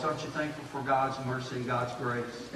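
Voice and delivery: normal talking voice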